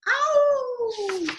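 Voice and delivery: high playful howl at the moon; wolf howl. Acted. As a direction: howl like a wild thing. Howling at the moon.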